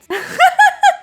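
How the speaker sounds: high loud laugh; burst of laughter. Real, not acted. Streamer laughing at her own exaggeration, loud. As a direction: laugh out at yourself.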